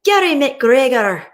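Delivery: mock Scottish accent for greeting a viewer; playful fake Scottish accent; bad Scottish accent. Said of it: "I'm gonna do a bad accent".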